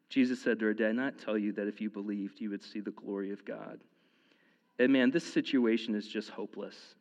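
usual speaking voice

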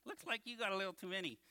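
ordinary talk

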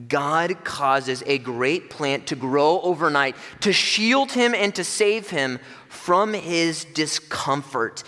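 slow, lifted emphasis on each phrase; emphatic, slowed. Acted. Preacher marvels at the miracle to the congregation, slow and emphatic. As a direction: lean into the miracle, slowing down.

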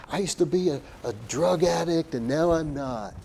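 hammed-up testimony voice; mock testimony. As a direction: lightly parody someone sharing their testimony. Mimicking a shallow testimony.

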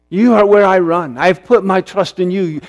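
normal talking voice

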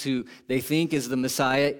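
usual speaking voice

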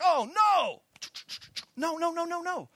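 high, frantic string of no's; cornered and frantically denying; panicked denial. Retold or imitated. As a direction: deny it desperately. You deny again, panicking.

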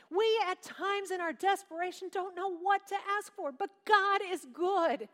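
rising fervent sermon cry; full-force preaching. Acted. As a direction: preach it out to the congregation.

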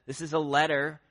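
normal talking voice

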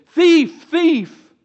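high, loud cry after a fleeing thief; shouting thief. Retold or imitated. Robbed person yelling after the thief, loud high shout. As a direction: yell for help as your wallet is stolen.

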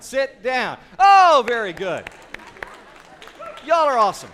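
high, loud cheer for the crowd; excited praise. Real, not acted. Preacher cheering the congregation after the song. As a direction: whoop them back to their seats.

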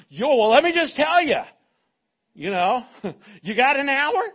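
a parent eager to unload prayer requests. Retold or imitated. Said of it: "If they go".

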